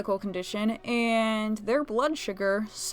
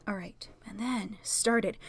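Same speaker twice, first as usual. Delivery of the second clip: soft whisper; 7 dB quieter, slower than usual. Real, not acted. After sipping tea.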